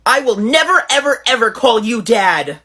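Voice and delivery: adamant refusal. Acted. Texter refusing to call ex-friend dad, indignant. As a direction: refuse outright, leaning on each "ever". Acted refusal in text quarrel.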